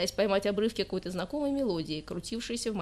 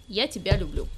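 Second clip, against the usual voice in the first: Я тебя люблю said a little brighter and louder, stress on я; a bit perkier; 3 dB louder than usual. Acted. Girl repeats it for her boyfriend, a bit perkier. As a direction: try again with a touch more cheer. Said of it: "ещё раз чуть пободрее повторила".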